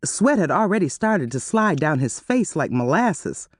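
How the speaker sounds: normal talking voice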